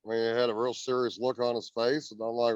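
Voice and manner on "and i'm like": ordinary talk, nothing marked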